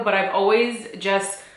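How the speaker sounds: ordinary talk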